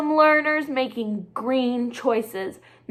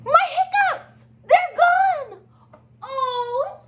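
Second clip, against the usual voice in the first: much higher, gasping with joy; delighted surprise; 14 semitones higher, 3 dB louder than usual. Acted. Little Penguin thrilled his hiccups are finally gone, squealing high. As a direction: gasp as the hiccups vanish.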